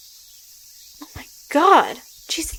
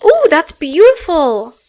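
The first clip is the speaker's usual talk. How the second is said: excited; 5 semitones higher, 6 dB louder, slower than usual. Real, not acted. Admiring game graphics.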